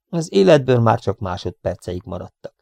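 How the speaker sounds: plain reading voice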